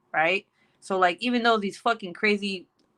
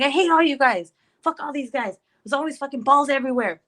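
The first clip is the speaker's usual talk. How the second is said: high, raised mock-angry rant; retold yelling; 5 semitones higher, 3 dB louder than usual. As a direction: act out blowing up at all the boys. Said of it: "I start yelling".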